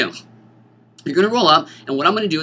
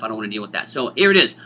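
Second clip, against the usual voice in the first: ordinary talk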